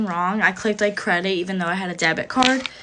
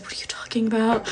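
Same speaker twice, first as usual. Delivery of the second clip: freaked-out whisper; 6 dB quieter than usual. Acted. Acted freaked-out reply to stranger.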